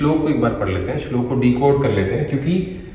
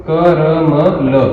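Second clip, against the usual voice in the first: क र म ल drawn out slowly, each syllable separate; letter by letter; 3 dB louder, much slower than usual. Shown as a demonstration. Teacher spelling out a memory word for students, letter by letter. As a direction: spell out क र म ल very slowly, one sound at a time.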